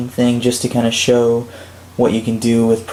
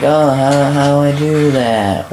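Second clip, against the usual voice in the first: higher, put-on helpless player voice; mock bewilderment; 4 semitones higher than usual. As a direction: pretend to be utterly stumped. Player acted out as stumped.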